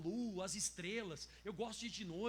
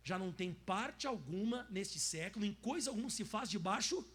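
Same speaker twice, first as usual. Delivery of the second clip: slow, louder rereading of the verse; repeated for emphasis; 4 dB louder than usual. Shown as a demonstration. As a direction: stress alguma, slowly. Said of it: "Volta lá no finalzinho". (releitura lenta e enfática)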